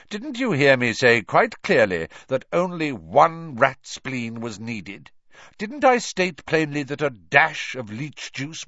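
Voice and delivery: loud scathing teacher; scolding. Acted. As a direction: berate him, cutting and loud. Teacher berating a pupil.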